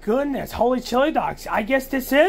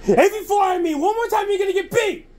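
angry threat; 8 semitones higher than usual. Acted. Threatening the cat.

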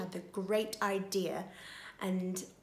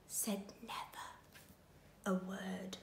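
whisper; 3 semitones lower, 15 dB quieter than usual. A narrator's reading. Ladybird silent, storytelling hush.

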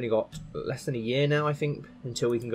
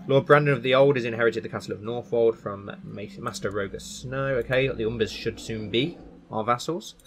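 ordinary talk

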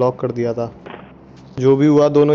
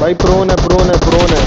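urgent callout; 4 semitones higher, 5 dB louder than usual. Real, not acted. Spotting an enemy in a live fight.

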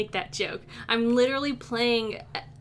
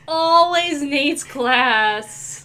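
exasperated whine; 4 semitones higher, 10 dB louder, much slower than usual. Acted. Character whining about class.

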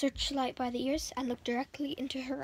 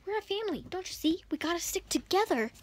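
put-on boy pleading; 6 semitones higher than usual. A narrator's reading. Willie pleads to keep family.

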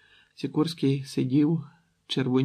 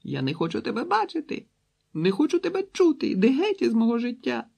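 much higher, tearful crying words; crying behind door; 11 semitones higher, 3 dB louder than usual. A narrator's reading. Daughter crying at her father through the door. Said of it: "За дверима почувся затяжний плач".

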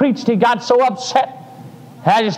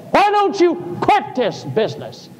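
a high, taunting devil voice; sneering tempter; devil's voice; 8 semitones higher than usual. Retold or imitated. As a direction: tempt him to quit. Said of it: "the devil whispered in my ear".

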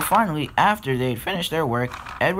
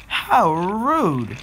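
higher-pitched offended exclamation; indignant; 7 semitones higher, 3 dB louder than usual. Acted. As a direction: exclaim it as an affronted onlooker. Offended engine reacts to being passed.

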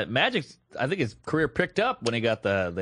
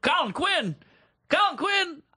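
high-pitched, cliché Brooklyn driver; over-animated mimicry, pitched way up; animated Brooklyn guy; 13 semitones higher than usual. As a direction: imitate an incredulous Brooklyn cabbie. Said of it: "he was so animated and so cliche".

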